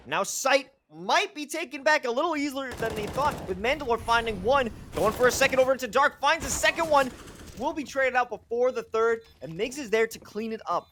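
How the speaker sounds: high, loud esports casting; excited play-by-play. Real, not acted. Caster calling a retake as kills land, excited play-by-play. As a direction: rattle off the kills with rising excitement.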